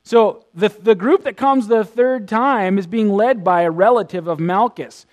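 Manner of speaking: normal talking voice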